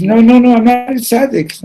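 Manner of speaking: high, loud acted denial; frantic protest. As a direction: protest in a panic, pitch way up.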